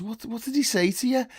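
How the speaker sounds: high, urgent barman voice; acted incredulous question; bewildered questioning. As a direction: ask it high and baffled.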